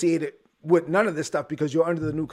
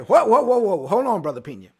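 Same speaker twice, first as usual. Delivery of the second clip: loud, higher mock alarm that fades; listener objecting; 3 semitones higher, 6 dB louder than usual. Listener stops the preacher, loud mock alarm fading out. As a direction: throw up your hands and stop him. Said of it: "you may be thinking".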